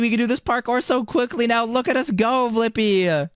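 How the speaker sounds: high-pitched excited rush; hyped up. Pretend. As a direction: squeal with speed-boost glee. Energy drink speeds them up.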